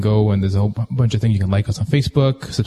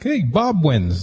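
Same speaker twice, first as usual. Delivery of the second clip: announcer voice; 6 semitones higher, much slower than usual. Pretend. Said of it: "it brings the person up and like".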